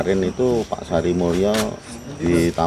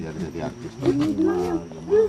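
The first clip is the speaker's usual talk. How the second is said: possessed high voice; 3 dB quieter than usual. Acted.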